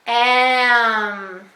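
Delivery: "Am" held long and loud; slowly, without stopping. Shown as a demonstration. Teacher saying words along with the child, "Am" stretched loudly. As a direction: draw "Am" out in one breath. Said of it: "say the words slowly with me".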